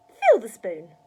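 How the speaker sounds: put-on very loud old-woman command; barked order. A narrator's reading. Grandma ordering George to pour medicine, barked order. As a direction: bark it as an order.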